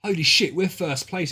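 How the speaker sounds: loud burst of real delight; excited shout. Real, not acted. Streamer tells the boys they're first, excited. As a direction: shout it out, thrilled to be winning. Team reaches first place.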